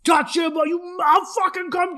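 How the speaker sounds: high-pitched mock furious shout; angry yelling. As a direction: yell it high, acting out a family row. Acted yelling quarrel with dad.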